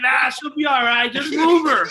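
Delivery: loud, high, laughing Jay imitation; laughing mock shout. Jay answering from his bed, laughing loudly. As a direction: brush it off through laughter.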